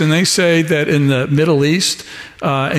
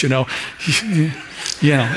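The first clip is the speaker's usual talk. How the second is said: ordinary talk, nothing marked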